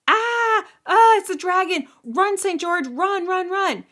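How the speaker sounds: high, loud frightened shrieking; mock-terrified princess yelling run; panicked scream. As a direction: scream a warning to flee.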